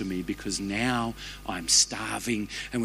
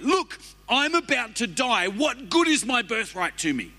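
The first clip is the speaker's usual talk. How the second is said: much higher, pleading and frantic; frantic Esau; 11 semitones higher than usual. Acted. Preacher acting Esau begging for red stew, high and frantic. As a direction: gasp it out like you're dying of hunger.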